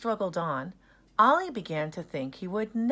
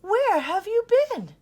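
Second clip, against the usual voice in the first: relieved question; 9 dB louder than usual. Acted.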